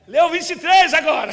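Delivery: loud, high mocking demon voice; demon's mocking command. The possessed sister's demon taunting the brothers, loud and mocking. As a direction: bark it out as the mocking devil.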